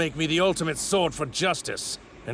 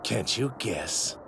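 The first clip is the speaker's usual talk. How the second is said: usual speaking voice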